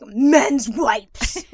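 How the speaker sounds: slow, loud, drawn-out "men's"; mocking drawn-out emphasis. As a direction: stretch "men's" out to mock it. Mocking macho men's wipes.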